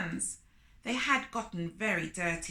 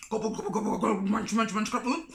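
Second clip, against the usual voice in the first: greedy gobble and munch noises; louder, playful eating noises; gobbling sounds; 4 dB louder, much faster than usual. A narrator's reading. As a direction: chomp through it like a greedy spider. Anansi gobbling food.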